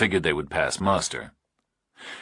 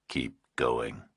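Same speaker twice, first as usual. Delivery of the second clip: slow, low, menacing whisper; distorted nightmare voice; 6 semitones lower, 8 dB quieter than usual. A narrator's reading. Kidnapper on the phone to Burke, slow eerie whisper. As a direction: breathe it like a monster on the line.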